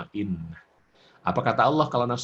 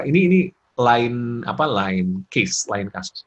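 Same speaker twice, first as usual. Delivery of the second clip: normal talking voice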